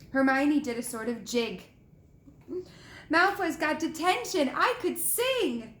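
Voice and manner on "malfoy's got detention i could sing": high, delighted, drawn out; gleeful. A narrator's reading. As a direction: bubble over with glee. Hermione dancing with glee.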